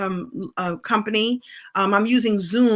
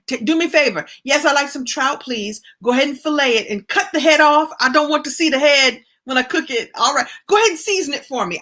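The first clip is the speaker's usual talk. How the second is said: higher-pitched, brisk customer placing an order; acted-out order; 4 semitones higher than usual. Customer ordering trout from the fish man, acted out in a higher voice. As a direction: re-enact asking politely but firmly at the counter. Retelling her order to the fishmonger.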